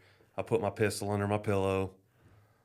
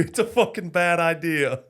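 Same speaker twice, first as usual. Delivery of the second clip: higher, louder, laughing rant; exasperated yelling; 7 semitones higher, 7 dB louder than usual. Retold or imitated. To his wife about the armadillo, exasperated laughing rant. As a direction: blow up half laughing at the absurd ask.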